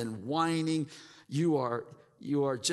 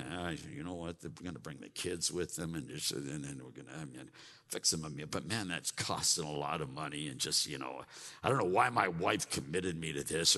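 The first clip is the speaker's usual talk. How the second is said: mock grumbling; 11 semitones lower, 7 dB quieter, faster than usual. Imitating grumbler muttering complaints.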